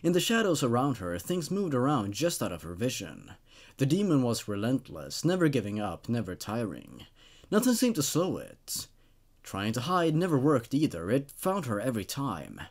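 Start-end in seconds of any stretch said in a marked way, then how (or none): none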